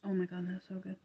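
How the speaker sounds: usual speaking voice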